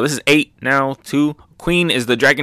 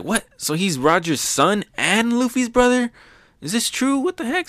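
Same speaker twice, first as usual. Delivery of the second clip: stunned Momo; 12 semitones higher than usual. Retold or imitated. Momo hears from Yamato about Ace, rising in disbelief. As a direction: pitch climbing higher and higher in disbelief.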